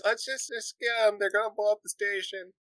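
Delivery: high-pitched, urgent-to-act character line; in-character Sansa. Acted. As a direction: pitch it well up, at normal volume. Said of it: "Sansa says".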